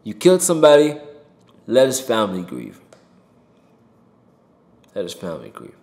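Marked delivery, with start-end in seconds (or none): 5.0-5.8 soft repeated plea. Real, not acted. Repeats plea, much softer.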